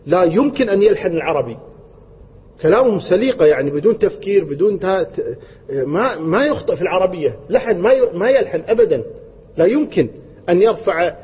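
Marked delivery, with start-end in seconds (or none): none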